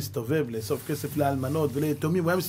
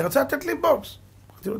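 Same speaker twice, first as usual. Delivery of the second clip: ordinary delivery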